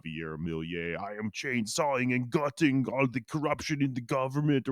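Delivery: mock strongman boast in an Austrian accent; hammed-up Austrian accent; wrong accent impression. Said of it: "I'm doing the wrong accent for him".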